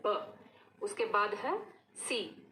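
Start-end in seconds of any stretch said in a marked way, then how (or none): 0.1-0.4 short ब with a puff of air; phonics b sound. Shown as a demonstration. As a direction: lips pressed, release ब with pressure. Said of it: "थोड़ा बंद करके ... साथ हवा छोड़ेंगे". (होंठ बंद करके दबाव से ब)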